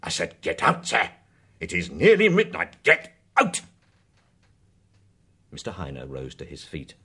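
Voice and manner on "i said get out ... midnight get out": angry. Acted. Bingham repeating the order.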